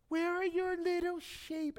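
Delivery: high, mocking taunt; high sneering jab at the kid brother; sarcastic ridicule. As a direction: tease him like a scornful big brother. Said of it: "now I was good at ridiculing".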